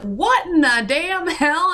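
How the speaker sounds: high, slow incredulous outburst; mock outraged disbelief, drawn out; Robert's disbelief. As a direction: imitate a stunned retired cop. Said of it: "he was just like".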